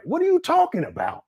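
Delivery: can't believe what he's hearing; baffled disbelief. Real, not acted. To viewers about Umar's claim, high baffled disbelief.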